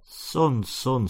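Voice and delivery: a flustered dad sputtering the words; spluttering. A narrator's reading. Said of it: "spluttered Gary's dad".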